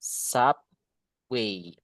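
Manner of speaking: SUBWAY slowly in two parts, "sap... way"; model pronunciation. Shown as a demonstration. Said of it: "You should say this one".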